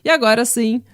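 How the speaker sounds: loud, projected outro announcement over music; promo read. Acted. Host urging listeners to support the podcast, loud promo read. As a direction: sell it to the listeners with punch.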